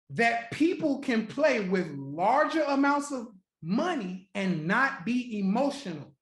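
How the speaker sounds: slow, deliberate restatement; said slowly. Shown as a demonstration. Repeating his point slowly for the audience. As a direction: spell it out slowly for the crowd. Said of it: "I'm gonna say it slow".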